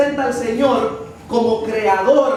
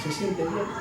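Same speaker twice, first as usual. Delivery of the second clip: soft, low concerned question; consoling; 5 semitones lower, 10 dB quieter than usual. Retold or imitated. Preacher retells checking on a troubled friend, softly consoling. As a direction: ask gently in a hushed, lowered voice. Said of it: "yo traté de consolarla".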